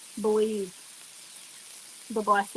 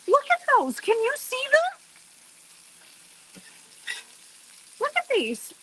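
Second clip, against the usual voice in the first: much higher, squealing with delight at the pancakes; excited squeal; 11 semitones higher than usual. Real, not acted. Cook shows viewers her fluffy pancakes, squealing high with delight.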